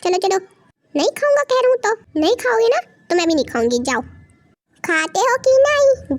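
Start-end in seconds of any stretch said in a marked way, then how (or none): 0.9-2.2 higher, stubborn sulking; sulky refusal. Acted. Brother sulking, refusing food. 4.8-6.2 slow, higher, drawn-out scolding; slow stern threat. Acted. Sister scolds brother to eat.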